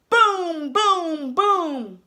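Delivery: loud footstep booms fading; booming footsteps. A narrator's reading. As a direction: stomp out each boom. Giant footsteps approaching.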